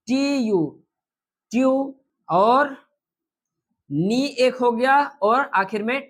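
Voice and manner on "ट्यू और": ordinary talk, nothing marked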